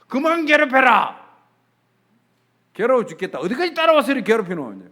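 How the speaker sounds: acted furious yell, loud and high; angry grandmother's outburst. Grandmother screaming at whatever torments her, angry acted yell. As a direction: imitate a tormented old woman lashing out at full volume. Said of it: "막 성질 부르고 막 욕을 하고".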